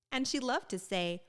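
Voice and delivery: usual speaking voice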